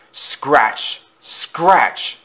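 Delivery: sharp, isolated noise word; scratching noise. A narrator's reading. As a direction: say it like a sudden scrape. Imitates strange noise in the night.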